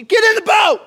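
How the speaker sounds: high, very loud command; shouted order. Acted. Jesus ordering reluctant disciples into the boat, shouted command. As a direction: yell it to end the argument. Jesus cuts off their protest.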